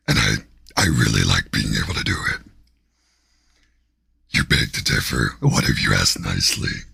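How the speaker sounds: very low, growling demon voice; deep, rumbling demon growl. Pretend. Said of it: "Five minutes of my demon voice".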